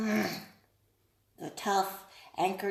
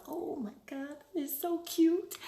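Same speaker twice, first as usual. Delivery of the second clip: imagined buyer's gush; 5 semitones higher than usual. Retold or imitated. Buyer feeling the charm before purchase, mild gush. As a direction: gush lightly over something cute. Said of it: "they have a feeling of".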